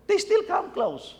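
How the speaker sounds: high, exasperated aside; a father's high exasperation. Real, not acted. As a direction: pitch it up in disbelief. Exasperated parent story.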